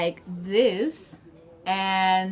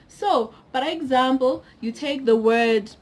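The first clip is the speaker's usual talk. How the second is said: normal talking voice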